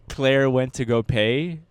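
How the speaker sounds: normal talking voice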